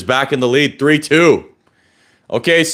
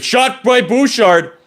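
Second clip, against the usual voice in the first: excited call; 13 semitones higher, slower than usual. Real, not acted. Shot called during play.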